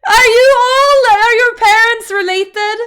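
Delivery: high, loud, tearful-with-laughter shout; hysterical mock outrage. Real, not acted. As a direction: yell in disbelief, cracking up. Mock outrage at Danish speakers.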